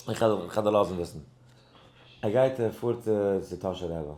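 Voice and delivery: ordinary talk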